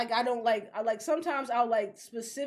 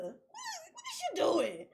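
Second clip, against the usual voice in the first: incredulous squeal; 8 semitones higher than usual. Real, not acted. Ranting to co-host about split seasons, incredulous high squeal. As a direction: squeak it out in mock horror.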